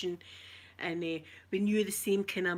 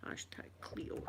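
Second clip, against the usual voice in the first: whispered aside; 15 dB quieter, slower than usual. Real, not acted. Typing a hashtag aloud.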